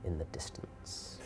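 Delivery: very soft, low whisper; fading whisper. Acted. Game master describes magic dissipating, fading to a whisper. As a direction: let it vanish into a whisper.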